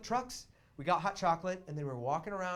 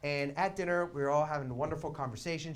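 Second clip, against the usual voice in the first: ordinary talk, nothing marked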